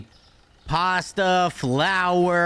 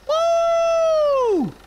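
high whoop; 5 dB louder than usual. Real, not acted. Celebratory whoop.

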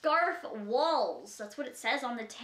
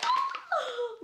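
high gasp. Real, not acted. Seeing phone case.

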